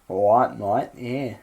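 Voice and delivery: lightly voiced cocky bloke; a hint of a smug geezer; Loki voice. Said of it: "a slightly King Midas-y voice".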